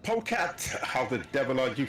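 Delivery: usual speaking voice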